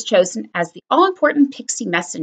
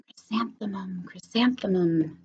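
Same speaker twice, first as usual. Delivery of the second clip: soft, hushed whisper; whispering flowers; 2 semitones lower, 10 dB quieter than usual. A narrator's reading. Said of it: "the flowers seemed to say".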